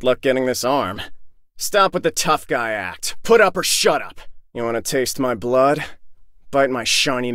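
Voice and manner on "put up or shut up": louder, higher-pitched confrontational demand; angry challenge. Acted. Fighter confronting a tough guy, angry challenge. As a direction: throw down the challenge forcefully.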